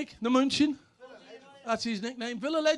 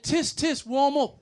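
retold shout; 6 semitones higher, 6 dB louder, slower than usual. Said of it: "he shouted".

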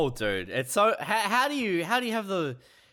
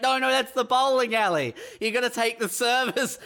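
higher, hurried-sounding correction; hammed-up insistent explanation; mock explaining; 7 semitones higher than usual. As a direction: play the squatter setting him straight. Acted squatter explains bowling alley to son.

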